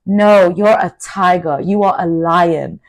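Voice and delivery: usual speaking voice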